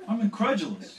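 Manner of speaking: loud, high scolding demand; loud and high, like a star catching a trespasser; hammed-up angry celebrity voice, loud and high. [name] voiced in story.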